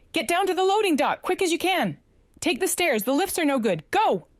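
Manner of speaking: high urgent shout. Acted. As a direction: yell the evacuation order. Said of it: "and shouted".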